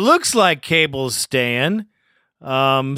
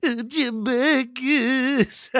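high, slow, whimpering mock sob; mock crying; 8 semitones higher, 4 dB quieter, slower than usual. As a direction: pretend to weep his name. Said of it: "have him cry like that".

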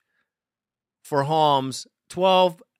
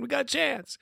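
soft echo; 9 semitones higher, 9 dB quieter, faster than usual. Real, not acted. Hopeful repeat, said quieter.

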